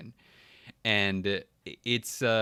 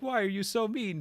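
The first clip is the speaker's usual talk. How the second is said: much higher, mock-hurt question; plaintive protest; 13 semitones higher than usual. Nerdy traveler asking airline staff, mock plaintive. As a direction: plead in an exaggerated high voice.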